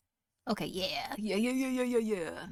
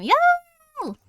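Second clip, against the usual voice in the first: much higher, louder excited yell; thrilled high-pitched yo; excited yelp; 9 dB louder than usual. Real, not acted. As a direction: squeal it out with glee. Excited exclamation over candy.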